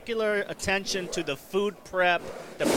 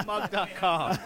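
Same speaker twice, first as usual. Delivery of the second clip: laughing; 4 semitones higher, 3 dB quieter, slower than usual. Real, not acted. Laughing at the mug.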